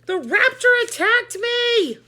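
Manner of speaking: high, loud, slow frightened wail; panicked cry. A narrator's reading. Emily on the gym floor, a loud panicked wail. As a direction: wail it in shock. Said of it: "she said".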